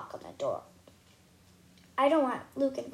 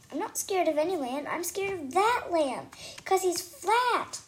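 spooked; 6 semitones higher, 3 dB louder than usual. Acted. Doll insisting the flat lamb scares her, rising.

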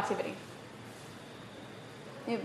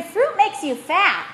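slow, higher mock-accented "Fruit makes you fat" with a hammed-up foreign accent; quoting a popular myth in a put-on accent, slow and a bit higher; mock accent quote; 5 semitones higher, slower than usual. Teacher quoting what people say about fruit, in a mock foreign accent. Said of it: "sorry if that was not understandable with the accent".

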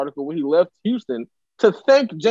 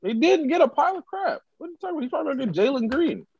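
high, incredulous objection; high-pitched protest; 11 semitones higher, 4 dB quieter, faster than usual. Real, not acted. Correcting a co-host about a trade, high-pitched protest. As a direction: protest in a much higher voice. Indignant protest at co-host's claim.

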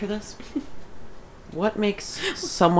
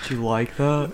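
lower, dopey meathead voice; dopey macho hunk; 6 semitones lower, 4 dB quieter than usual. Retold or imitated. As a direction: play a slow-witted gym bro. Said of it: "do your best Blaze impression".

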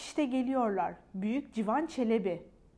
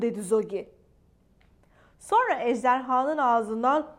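usual speaking voice

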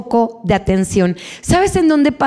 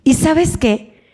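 ordinary talk, nothing marked

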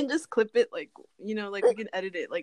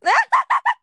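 sharp, high, loud gasp; real sudden noisy gasp; 10 dB louder than usual. Real, not acted. Friend reacting to a name on the document, loud gasp.

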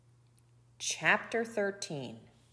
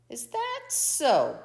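mild growl, slow and louder; slow, higher-pitched menacing coyote; growled challenge; 4 semitones higher, 5 dB louder than usual. A narrator's reading. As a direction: draw it out as a challenge. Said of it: "growled Coyote".

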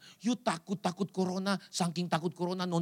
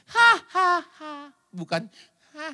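1 mock laughter; 7 semitones higher, 5 dB louder, slower than usual. Forced laugh demo.

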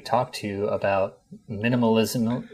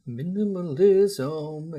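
Swedish accent; 7 semitones higher, much slower than usual. Shown as a demonstration. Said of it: "you have to say it with a Swedish accent".

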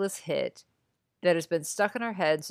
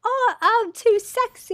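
much higher, louder, slow squeal; 7 dB louder, slower than usual. Pretend. Joining the [name] bit with a much higher louder squeal. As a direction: squeal it like a giddy pop star. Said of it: "it's [name] again".

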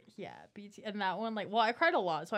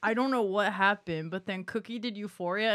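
ordinary talk, nothing marked

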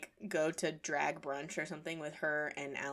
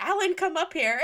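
calling out; 12 semitones higher, 7 dB louder than usual. Retold or imitated. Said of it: "she was like".

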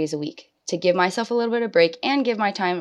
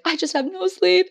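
much higher, whiny complaint; mock whine, much higher; whiny exhausted; 12 semitones higher than usual. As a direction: moan it like a worn-out mom. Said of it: "you're like".

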